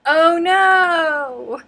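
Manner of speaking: loud high cry then laugh; startled shout into laughter; surprised. Real, not acted.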